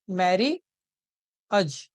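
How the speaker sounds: "मैरी, अज" slowly with a long gap between; split in two. Shown as a demonstration. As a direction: sound out "मैरी", pause, then "अज". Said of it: "दो हिस्सों में तोड़ सकते हैं". ("मैरी... अज" धीरे, दो हिस्सों में)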